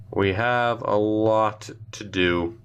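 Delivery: slow, drawn out, word by word; weighty, deliberate restatement; slow emphatic repeat. Real, not acted. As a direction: stretch it out to let it sink in. Repeats himself slowly for emphasis.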